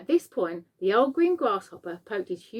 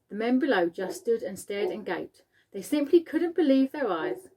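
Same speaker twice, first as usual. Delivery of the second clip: ordinary delivery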